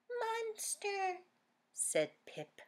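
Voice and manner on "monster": squeaky little-friend echo; tiny Pip voice. Acted. Little Pip echoing Daisy in a squeak. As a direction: pipe it up high like a tiny creature.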